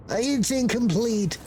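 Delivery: a frazzled little bureaucrat caught off guard; nervous clerk voice. Acted. Clerk role.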